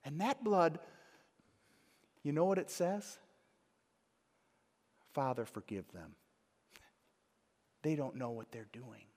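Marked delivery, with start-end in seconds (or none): 5.1-9.1 tender prayer. Acted. Quoting Jesus on the cross.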